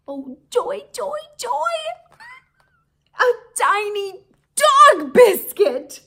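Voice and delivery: high, loud squealing joy; overjoyed squeal. Acted. Humphrey delighted by a biscuit, high squeal.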